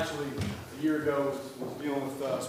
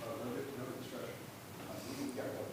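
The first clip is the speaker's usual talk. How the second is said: urgent hushed; 10 dB quieter than usual. Retold or imitated. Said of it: "She was like".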